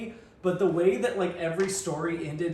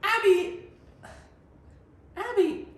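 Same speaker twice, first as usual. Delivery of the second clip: very high, loud put-on child voice saying Abby; kid voice; 5 dB louder than usual. Shown as a demonstration. Co-host asks for his best kid voice, very high. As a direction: squeak Abby like a little kid. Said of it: "your best kid voice".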